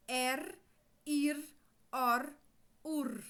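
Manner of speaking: er, ir, or, ur each said alone, short, with the R sounded at the end; R sound drill. Shown as a demonstration. Teacher drilling the children on the R sounds, one by one. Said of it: "o sonzinho do ar".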